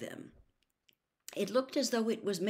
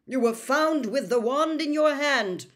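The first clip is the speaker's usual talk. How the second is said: loud, raised, forceful bark; barked accusation; 7 semitones higher, 8 dB louder than usual. A narrator's reading. Wizard official accuses the trembling elf, barking. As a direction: snap it at the culprit. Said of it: "barked Mister Diggory".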